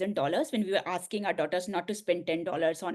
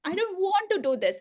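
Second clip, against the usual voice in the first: retelling a teary plea, pitched much higher and a bit louder; tearful, higher pleading; 12 semitones higher, 4 dB louder than usual. Retold or imitated. Telling husband she won't take the job, high tearful plea. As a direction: replay the choked-up refusal, high and pleading. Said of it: "all the tears rolling".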